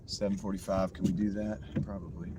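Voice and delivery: hoarse, strained voice fading. Real, not acted. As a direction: voice giving out, rough and tired. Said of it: "My voice is giving out".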